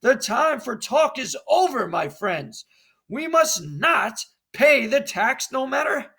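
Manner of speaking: a patriot stirring up the crowd, rising in pitch and volume; rally your friends against the tax; rousing colonist speech. Acted.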